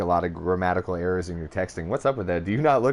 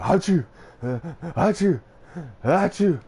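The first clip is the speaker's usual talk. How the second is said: imitated sneezes; 8 semitones higher than usual. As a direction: act out sneezing back to back.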